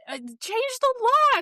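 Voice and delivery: high, slow, disbelieving; can you believe he changed the locks; exasperated. Real, not acted. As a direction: drag it out in outraged disbelief. Outraged retelling of TV scene.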